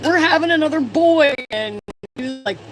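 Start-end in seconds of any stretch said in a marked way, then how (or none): 0.0-1.3 much higher and louder, bursting with news; excited announcement. Retold or imitated. Telling husband Fred about another boy, thrilled and loud. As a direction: blurt it out to your husband. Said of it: "I'm like".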